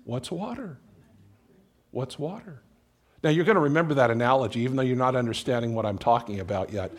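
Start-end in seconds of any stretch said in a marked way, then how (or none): 0.0-2.6 puzzled fish. Acted. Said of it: "And the fish says".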